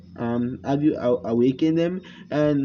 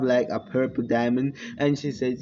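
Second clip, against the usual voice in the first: ordinary delivery, nothing marked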